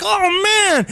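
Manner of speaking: high, loud, gushing reunion; hammed-up delight at an old friend; both friends gushing on the phone, excited; mock excited reunion. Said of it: "And you're like".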